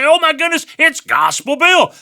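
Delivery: like spotting a TV star you grew up with; amazed recognition. Retold or imitated. Churchgoers recognizing Gospel Bill.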